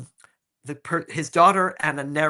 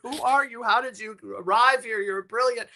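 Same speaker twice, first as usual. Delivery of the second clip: high-pitched amazed gushing; gushing admiration; 8 semitones higher than usual. Retold or imitated. Panel raving to an auditionee, high mock-awed gush. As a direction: imitate a panel swept away, voice pitched way up. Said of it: "They just were like".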